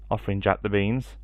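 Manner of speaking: ordinary delivery, nothing marked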